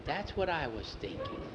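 soft, secretive reply; hushed agreement. A narrator's reading. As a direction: agree under the breath, keeping it secret. Secret agreement to run away.

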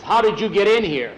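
indignant foreman in a told story; angry challenge. Acted. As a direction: confront the intruders, acting it out. Foreman confronts intruders in acted quarrel.

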